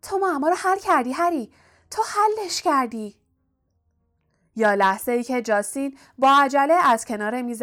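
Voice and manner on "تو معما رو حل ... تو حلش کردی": mild excited squeal. A narrator's reading. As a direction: cry it out high, as an overjoyed friend. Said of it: "هرمایونی جیغ کشید و به سویش دوید و گفت".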